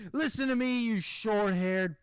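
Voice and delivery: hammed-up telling-off; mock insult. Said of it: "even if she did that".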